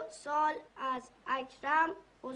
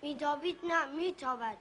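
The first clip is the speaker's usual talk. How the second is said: schoolbook reading; 4 dB quieter than usual. Acted. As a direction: sound out the primer line by line. Boy reads lesson aloud.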